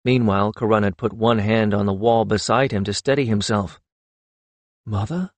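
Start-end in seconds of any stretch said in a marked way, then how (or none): 4.9-5.3 softly stunned question; understated gasp of disbelief. A narrator's reading. Karun suddenly seeing his mother, softly gasped. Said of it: "he gasped".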